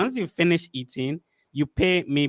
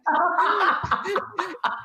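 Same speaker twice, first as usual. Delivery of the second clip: high laugh; 14 semitones higher than usual. Real, not acted. Host laughing at the guest's American accent.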